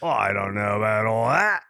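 modest reply in put-on Australian accent. Podcaster answering praise for his impression, put-on Australian accent. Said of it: "the best Australian a-, accent".

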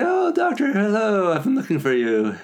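excited greeting. Retold or imitated. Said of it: "He's like".

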